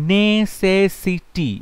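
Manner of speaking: Necessity drawn out very slowly; teacher stretching the word for students; slow demo pronunciation. Shown as a demonstration. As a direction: sound out necessity syllable by syllable. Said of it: "এখানে necessity নয়".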